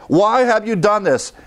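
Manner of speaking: high, frightened question; alarmed sailors. Retold or imitated. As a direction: cry it out in alarm. Sailors asking Jonah.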